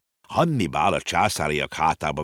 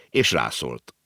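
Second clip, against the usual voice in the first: ordinary delivery, nothing marked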